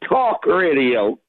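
low, slow try at New York "talk radio"; attempted New York accent. Shown as a demonstration. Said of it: "Let me hear it".

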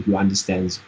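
normal talking voice

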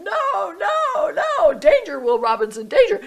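high, loud alarm wail; acted panic. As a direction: play the frantic inner voice warning of danger. Voices the fearful part of herself.

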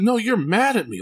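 put-on a little boy digging in his heels, high and firm; adamant son. Son insists to dad that he's mad, high adamant kid voice. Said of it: "Like, he was adamant".

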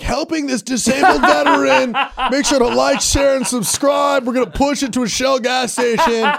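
high, slow, gushing influencer voice; selfie influencer. Pretend. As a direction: ham up a vlogger milking the good deed for views. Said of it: "every guy would have their phone out doing a selfie".

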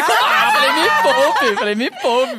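loud, high, scoffing outburst; indignant. Real, not acted. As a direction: brush it off loudly. Dismissing the crush idea. (indignada e alta, desdenhando)

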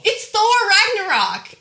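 high, loud, drawn-out answer reveal; slow, high-pitched exclamation; exclaimed reveal. Real, not acted. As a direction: burst out with the answer they missed.

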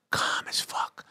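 breathy whisper, dropped right down. Acted. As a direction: hush it like a secret. Whispered punchline about calm beaches.

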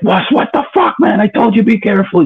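high, strained mock yell, retelling a friend's outburst; imitated screaming. Friend screaming at Wes after his overdose, high mock yell. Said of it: "He's screaming in my face".

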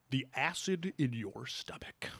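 slow, hushed, drawn-out teacher voice; slow teacher drone. Retold or imitated. Chemistry teacher droning to his class, slow and hushed. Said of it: "he would talk like that".

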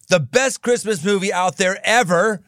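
ordinary talk, nothing marked

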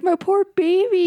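high, cooing mom voice; doting mom. Retold or imitated. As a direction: fuss over a bruise like a doting mom. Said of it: "but she kept saying, like".

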